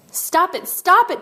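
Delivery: high, loud panicked cry; screamed plea. A narrator's reading. Boy sees his pigeon in danger, high screaming protest. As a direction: yell it as an urgent protest. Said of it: "Tony screamed".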